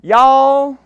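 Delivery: loud, high East Texas drawl on y'all; East Texas accent. Retold or imitated. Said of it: "in the best East Texas accent she could get".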